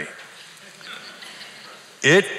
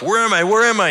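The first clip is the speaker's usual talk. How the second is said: panicked call, much higher and louder; 12 semitones higher, 6 dB louder, faster than usual. A narrator's reading. Old man waking in hospital and calling out in panic. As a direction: cry out in confusion on waking. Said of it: "he called".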